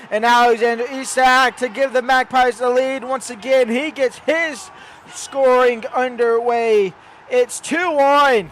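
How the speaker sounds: excited goal call. Acted. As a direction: build to the goal and draw it out.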